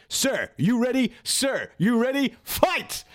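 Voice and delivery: high, slow ring call; referee voice. Retold or imitated. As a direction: call the fight start like a ref. Imitating referee starting fight.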